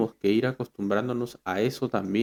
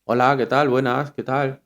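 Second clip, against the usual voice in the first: louder, bold hello as the Spaniards do; loud confident greeting; 3 semitones higher, 5 dB louder than usual. Retold or imitated. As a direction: say it out loud and sure of yourself.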